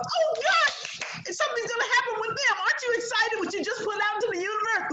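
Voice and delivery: gleeful high-pitched rush; mock excitement. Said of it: "I'm so excited for you".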